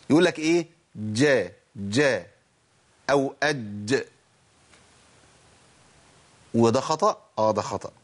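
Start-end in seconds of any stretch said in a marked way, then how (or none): 1.0-4.1 "جا" and "أج" with a d sound mixed into the j; jeem tinged with dal. Shown as a demonstration. Teacher imitating a common mistake, "جا" and "أج" with d-tinged jeem. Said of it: "في ناس بتنطق الجيم مشوبة بدال".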